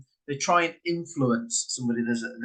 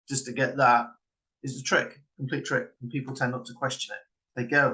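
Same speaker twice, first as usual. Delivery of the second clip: usual speaking voice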